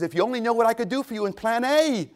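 high, loud exhortation; impassioned appeal. Acted. Jesus to believers clinging to backup plans, impassioned appeal.

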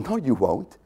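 usual speaking voice

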